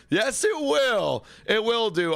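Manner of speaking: high, slow, stretched yes; drawn-out happy yes. Real, not acted. Streamer cheerfully answering a fan's donation, stretched-out delight. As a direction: savour every word like a win.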